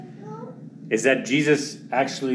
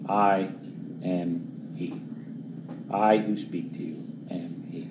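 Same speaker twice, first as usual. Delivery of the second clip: hushed reveal; 5 semitones lower, 8 dB quieter, slower than usual. Acted. Jesus reveals himself, hushed.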